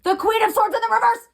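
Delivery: high, fast excited card call; heated exclaim. Real, not acted. Tarot reader exclaims the reversed card to viewers, heated. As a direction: announce the card like a revelation. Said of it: "I'm getting so heated".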